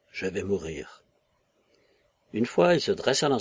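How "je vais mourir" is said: understated dread, lower and softer; terrified thought. A narrator's reading. Boy alone in bed fearing the illness, terrified thought. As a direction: let the repeat sink down, hushed by fear. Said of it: "terrifié".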